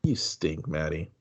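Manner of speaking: soft, subdued put-down; mock disappointed parent. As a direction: deliver the verdict quietly, lightly acted. Acts parent's quiet verdict on daughter.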